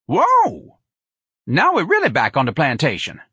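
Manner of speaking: swooping high whoa, then lively jeering; mocking Jones character. A narrator's reading. Jones heckling the plantation act, mocking. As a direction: jeer with a long high whoop.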